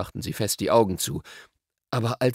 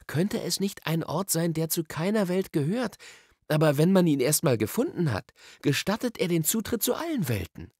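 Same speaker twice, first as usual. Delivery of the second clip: put-on high boy-character voice, insistent; Diggory, eager; 7 semitones higher than usual. A narrator's reading. Diggory likens the wood to the tunnel, eager. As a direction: press the explanation, pitch lifted.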